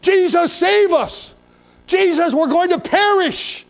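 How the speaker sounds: high, desperate cry for help; yelling over wind. Acted. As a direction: yell it out, stretching the final plea. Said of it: "They're having to yell".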